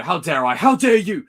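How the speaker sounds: rising to a high, loud hammed-up how dare you; hammed-up fury building; mock anger. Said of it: "he's like".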